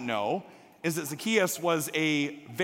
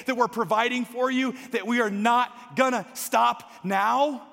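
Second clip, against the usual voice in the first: higher, firm parental lecture; lecturing; 6 semitones higher, 4 dB louder than usual. Retold or imitated. Mother lecturing her son about trust, exasperated. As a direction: drive the point home to your son. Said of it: "as I'm lecturing my son".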